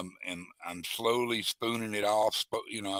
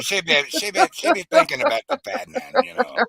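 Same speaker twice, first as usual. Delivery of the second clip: mock gossip voice; 9 dB louder than usual. Teasing about Jody, high put-on voice.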